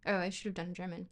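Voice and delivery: usual speaking voice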